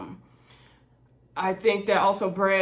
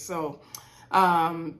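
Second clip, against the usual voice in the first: ordinary talk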